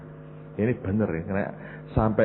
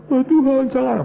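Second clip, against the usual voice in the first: mock frightened plea; 7 dB louder, slower than usual. Jemaat takut mati, memohon.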